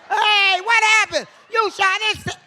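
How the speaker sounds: loud, high shouted outburst. Acted. Yelling in mock surprise at the audience. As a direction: yell it up high in mock alarm.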